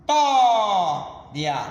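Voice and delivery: "tó" held very long, then "ria" short; stress on TÓ. Shown as a demonstration. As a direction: stretch the stressed "tó" of história, then drop off. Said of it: "vai ser a mais forte".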